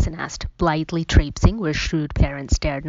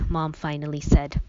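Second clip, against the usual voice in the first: ordinary delivery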